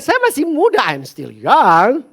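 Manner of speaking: put-on calm your child by stretching the time; reassuring dad. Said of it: "saya bilang".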